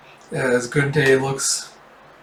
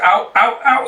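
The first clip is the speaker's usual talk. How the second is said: high repeated yelps of pain; acted pained yelps; 9 semitones higher, 4 dB louder than usual. Thug as his fist is bent down, acted pained yelps. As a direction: yelp it in mock agony.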